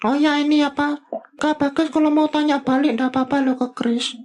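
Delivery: high puppet voice nudging Kak Bagas to ask back; Bobi voice. Acted. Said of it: "Bobi tanya lagi deh".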